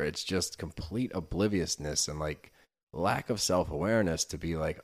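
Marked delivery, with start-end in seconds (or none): none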